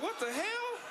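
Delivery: high, startled mock shout of shock. Comic retells partner's surprise in bed, shocked. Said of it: "I was like".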